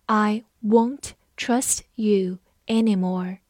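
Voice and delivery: slow word-by-word reading, pauses between words; 慢速示范朗读. Shown as a demonstration. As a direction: read it slowly, one word at a time. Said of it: "我们慢慢来读". (慢慢地逐词朗读)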